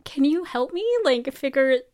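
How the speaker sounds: overwhelmed and pleading, playfully; mock amazement. Reacting to Cicely's cheekbone remark, high amazed pleading. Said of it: "I'm like".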